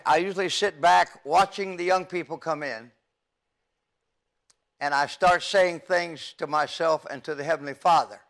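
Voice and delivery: normal talking voice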